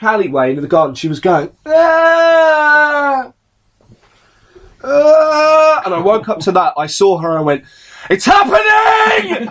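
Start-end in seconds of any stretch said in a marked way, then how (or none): 1.7-5.8 long, high, loud zombie-like wail; imitated drunken wail. Imitating drunk woman's wail outside. 8.1-9.5 high, drawn-out alarm cry; panicked shout. Retold or imitated. Retelling panic at supposed zombie outbreak.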